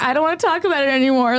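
imitated crying. Friend refuses comfort at dinner, mock tearful. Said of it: "like, crying".

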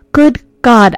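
frightened gasp. A narrator's reading. Woman alone in a haunted house gasps in fright. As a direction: blurt it out in fright. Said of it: "I gasped".

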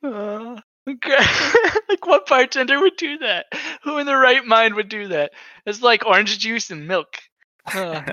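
fighting back laughter; barely holding in a laugh; stifled giggles. Real, not acted. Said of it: "giving myself an aneurysm trying not to laugh".

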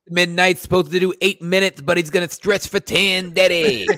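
hammed-up announcer bit; mock announcer. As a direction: hype the comic like an emcee. Announcing a comic joking.

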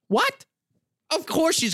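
high incredulous squeak; outraged what. Real, not acted. Reacting to the grandma and mom's handmade dress, an incredulous high what. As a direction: scoff in total disbelief.

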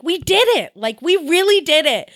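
high-pitched, excited retelling of a triumph; imitating elation. Retelling [name]'s joy at going platinum, elated. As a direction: voice his elation, pitched well up. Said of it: "[name] was absolutely elated".

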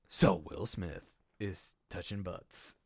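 low, slow trailer narrator voice; movie trailer voice. Pretend. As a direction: draw it out like a mild epic trailer. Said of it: "I'm gonna use my director pitch".

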